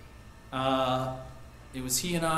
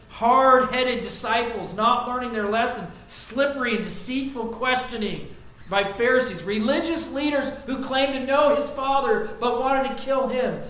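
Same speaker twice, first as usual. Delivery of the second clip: higher, louder building preaching list; full preaching; 6 semitones higher, 6 dB louder than usual. Acted. Preacher lists Jesus' sufferings to the church, building loud. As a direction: drive each hardship home, rising.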